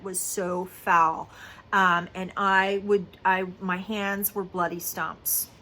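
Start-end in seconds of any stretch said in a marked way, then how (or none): none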